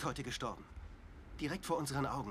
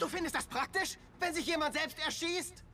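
outraged; 8 semitones higher than usual. Acted. Outraged at cold remark.

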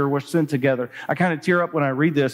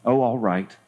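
reluctant assent; 4 semitones lower than usual. Acted. Corrie gives in when prodded.

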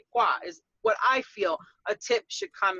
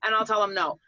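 ordinary talk, nothing marked

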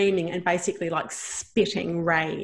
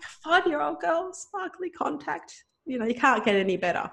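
delighted; 9 semitones higher than usual. Retold or imitated. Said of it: "I'm like".

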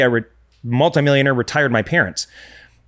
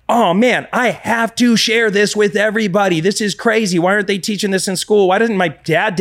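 mock amazement; 8 semitones higher than usual. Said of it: "you're like".

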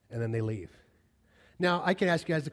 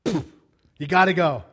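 Abraham sending them off; 3 semitones higher, 9 dB louder than usual. Retold or imitated. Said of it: "A big cantina is like".

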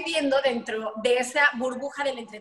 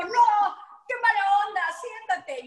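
high, loud "sit down, not cool"; mock scolding; 7 semitones higher, 5 dB louder, slower than usual. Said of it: "yo así como". (regañando en broma, agudo y fuerte)

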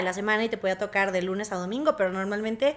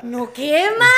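louder, emphatic repeat; 4 semitones higher, 8 dB louder, much slower than usual. Real, not acted. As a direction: insist it doesn't burn. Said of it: "le invierto un poquito más".

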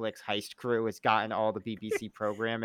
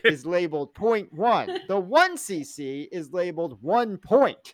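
slow, louder mock lecturing; Cuddy as pompous boss; 4 semitones higher, 5 dB louder, slower than usual. Cuddy briefing House, slow hammed-up lecturing voice. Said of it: "Cuddy is just like".

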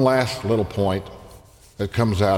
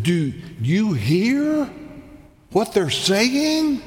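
much higher, slow, outraged question; indignant authorities; 12 semitones higher, much slower than usual. Retold or imitated. Indignant leaders protesting to Jesus about the children, slow and outraged. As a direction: sneer it as the offended temple leaders. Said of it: "And they said".

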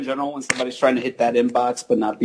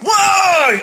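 sudden high shout of alarm; startled shout; 5 dB louder than usual. Retold or imitated. Getting calls from international people, shouted whoa. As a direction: yelp it out, caught off guard by a strange call. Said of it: "I'm like".

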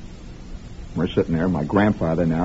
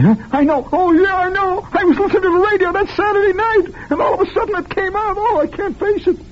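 much higher, strained voice of a grown man on a couch; hammed-up shrill patient reliving his trauma; mock traumatized future listener. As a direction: squeak it out like the memory is too awful to face. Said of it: "and one night you say".

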